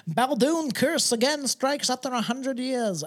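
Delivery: high, slow mock newsreader; headline read. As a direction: announce it like an old newspaper headline. Reads out an old newspaper headline.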